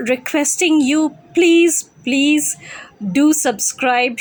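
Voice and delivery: ordinary delivery, nothing marked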